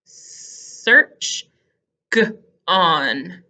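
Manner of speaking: long s into SEARCH, then G, pause, ON; spaced-out Search, g...on; sounded-out onset. Shown as a demonstration. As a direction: separate g- from on. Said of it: "on sounds with the g".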